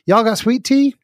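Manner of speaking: high, loud hopeful Southern ask; eager Southerner. Retold or imitated. Southern coworker asking every California restaurant, high and eager. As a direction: call it out hopefully like a homesick Southerner.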